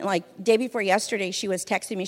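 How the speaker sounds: ordinary talk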